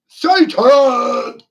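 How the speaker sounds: very high, very loud cry of Satan; mock shriek. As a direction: shriek it like a horror film. Mock cry naming the devil.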